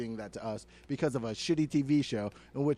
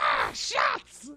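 rowdy emu voice. Acted. Emu in a pub yelling for shots. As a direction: squawk it like a drunk bird demanding shots. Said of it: "I love doing that voice".

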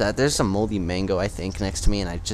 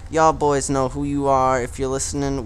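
raised pitch, slightly reined-in volume; toned-down shout-out; 6 semitones higher, 4 dB louder than usual. Real, not acted. Podcaster re-greeting gaming buddies, loudness reined in. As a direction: repeat the shout-out without blasting the mic. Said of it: "This is probably maxing out really bad".

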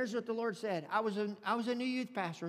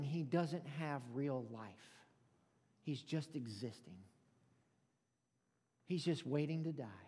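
hushed gravity; 6 semitones lower, 7 dB quieter than usual. Retold or imitated. Quoting God on empty lives.

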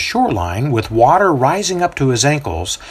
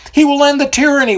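much higher, louder hopeful shout; one in the crowd shouting hopefully; 4 dB louder, faster than usual. A narrator's reading. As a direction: call it out over the crowd. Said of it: "one shouts".

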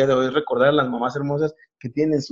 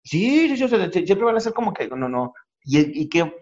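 normal talking voice

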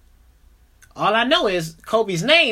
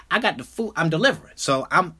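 ordinary delivery

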